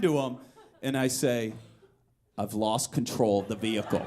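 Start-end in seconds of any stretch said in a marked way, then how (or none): 2.4-4.1 calm deadpan. Retold or imitated. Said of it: "I'm almost weirdly calm".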